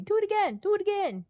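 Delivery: high, pleading "do it again"; kids begging. Retold or imitated. Classmates begging an encore: high eager voice. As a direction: beg like excited schoolkids, high up. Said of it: "people would come up to me".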